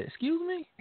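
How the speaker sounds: high, incredulous squeak of surprise; mock disbelief. Replaying his reaction to a buddy's text, incredulous. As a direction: act stunned by a friend's text. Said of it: "And I said".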